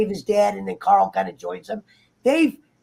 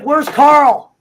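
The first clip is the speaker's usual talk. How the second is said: louder, higher "Where's Carl?" call; mock call out; 5 semitones higher, 6 dB louder than usual. Mocking the lazy scene.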